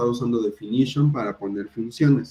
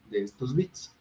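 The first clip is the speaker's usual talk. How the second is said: ordinary delivery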